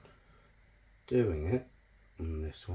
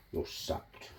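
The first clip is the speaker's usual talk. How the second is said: whispered mock boss; 14 dB quieter than usual. Mock boss firing him.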